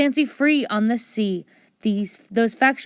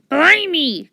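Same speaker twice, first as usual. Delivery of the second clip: loud, higher pirate blimey; louder, astonished blimey; pirate exclamation; 7 semitones higher, 7 dB louder than usual. Acted. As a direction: cry it out like a startled pirate. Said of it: "cried Big Pete".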